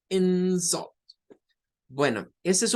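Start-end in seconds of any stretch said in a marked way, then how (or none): 0.1-0.9 forceful IN, then let sult drop in "insult"; stress on first syllable. Shown as a demonstration. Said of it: "en el otro caso vamos a decir algo como".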